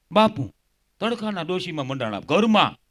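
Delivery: ordinary talk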